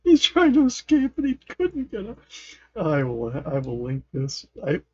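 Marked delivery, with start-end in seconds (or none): 0.0-2.2 high, loud laugh-talk. Real, not acted. Streamer cracking up over a player's failed escape, laughing. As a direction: squeal it out through real laughter. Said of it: "I'm laughing".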